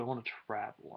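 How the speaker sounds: ordinary talk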